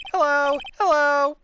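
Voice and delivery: high, loud parrot hello; parrot squawk. Acted. Voicing Polly the parrot.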